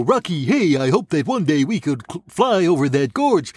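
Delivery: high goofy moose voice; dopey cartoon moose; Bullwinkle voice. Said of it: "I don't know how to do all those voices".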